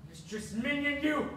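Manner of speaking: ordinary talk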